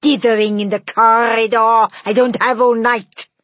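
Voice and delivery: loud, scolding witch in a strange voice; impatient witch. A narrator's reading. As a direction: order them in, fed up. Grand High Witch keeps scolding.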